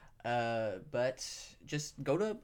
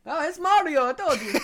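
high, loud cartoon Mario voice; Mario impression; 14 semitones higher, 9 dB louder than usual. Boatman introducing himself, Mario-style Italian voice. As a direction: bounce it like the video game plumber.

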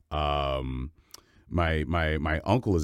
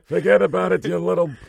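high, loud put-on old man with Yiddish flavour; exasperated Yiddish grandpa grumbling at kids; cartoon Yiddish old fish, oy vey and all; grumpy old Yiddish voice; 12 semitones higher, 7 dB louder than usual. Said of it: "And he goes".

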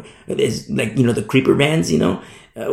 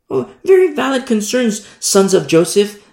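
mock sympathy; 8 semitones higher, 3 dB louder than usual. Mock-earnest reply to Bible figures.

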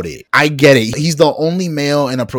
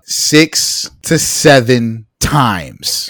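loud and very slow, word by word; emphatic disbelief; 5 dB louder, much slower than usual. Real, not acted. Podcaster repeating the rewatch count to cohosts, slow loud disbelief. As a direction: hammer out each word, appalled.

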